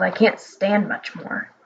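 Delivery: low, slow, soft lament; despairing old wizard. Acted. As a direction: moan it out, at the end of his rope.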